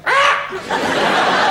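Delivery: very high unvoiced shriek of rage; breathless high shriek; strangled shriek. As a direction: let out a wordless strangled cry. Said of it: "said something very coherent, like".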